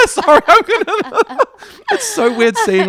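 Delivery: very high and loud, breaking into laughter; laughing. Real, not acted. Host cracking up about Brat Summer, real laughing. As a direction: laugh through the words, can't hold it.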